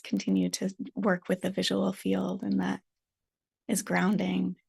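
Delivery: calm meditation guide with long silences; guided meditation. Real, not acted. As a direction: settle listeners gently, phrase by phrase.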